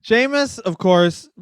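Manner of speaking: a shaky mock Irish accent; a self-admitted poor brogue; bad Irish accent. Mock Irish accent for the co-host's Sheamus bit. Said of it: "my shitty accent aside".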